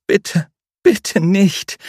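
high, slow, drawn-out begging; slow pleading. A narrator's reading. Julia begs her captor, slow high pleading. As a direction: stretch each plea out in fear. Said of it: "flehte sie".

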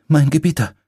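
high, shaky and fearful plea; a frightened servant addressing her master; trembling voice. A narrator's reading. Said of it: "mit zittriger Stimme".

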